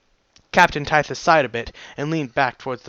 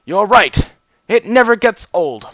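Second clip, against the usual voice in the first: louder, higher, wholehearted agreement; enthusiastic agreement; 5 semitones higher, 7 dB louder than usual. Acted. Character agreeing with a friend about the cider, enthusiastic. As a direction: agree brightly, lifting pitch and volume.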